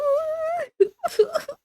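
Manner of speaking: very high, squealing laugh; shrieking laugh. Real, not acted. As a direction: lose it completely laughing. Cracking up after drawl bit.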